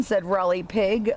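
ordinary delivery, nothing marked

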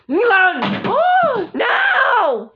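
high, loud protest with a gasp; upset shout. Acted. Quarrelling girl telling the other to back off, upset shout. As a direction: cry out high, begging to be left alone.

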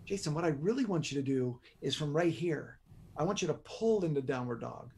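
calm yoga teacher easing a student into the pose; soft inviting cue. Shown as a demonstration. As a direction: coax him to pull, softly. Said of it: "the inflection in my voice".

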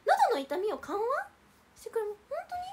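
high, loud delighted surprise; thrilled by what she reads; squealing really?! at good news; excited. Real, not acted. Delighted at reading.

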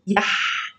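يح breathed out alone, unvoiced; isolated syllable. Shown as a demonstration. Teacher to children, isolating the syllable يح in a whisper. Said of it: "وآخد الحرف اللي قبليه".

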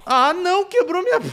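high, loud shocked cry breaking into laughter; shocked dismay. Real, not acted. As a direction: gasp in disbelief, then laugh. Bow breaks mid-fight.

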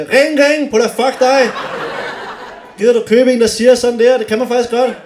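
high, loud mock ring ring with laughter; mimicking a bell-ringer. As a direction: squeal it like an excited cyclist mocking a bell.

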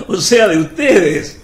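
laugh, then draw out the jibe; mocking laugh. Judas jeering at the Pharisees, mocking. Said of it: "Judas se ríe burlonamente".

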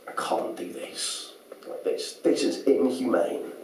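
slow, hushed inner voice; soft and halting, losing your nerve; reluctant hushed thought. Acted. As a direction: think it aloud, slowly and very quietly. Agonised thought over killing mouse.

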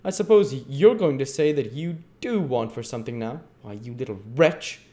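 high, loud, scandalised tirade; indignant scolding. Acted. Missus Mann berating Dick for wanting something, indignant.

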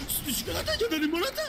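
high mimicking voice. Players parroting a silly catchphrase, high mimicking voice. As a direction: echo the catchphrase in a high, altered voice.

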